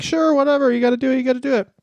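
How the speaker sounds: sarcastic reply to the Canadian logic; mock dismissive. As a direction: humour the guy with a sneering whatever. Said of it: "it's like".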